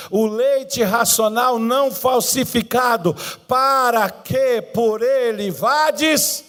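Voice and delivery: slow, deliberate Bible reading; solemn, slow recital of the verse; scripture reading. Acted. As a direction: read each phrase out, drawn out.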